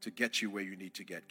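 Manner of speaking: ordinary talk, nothing marked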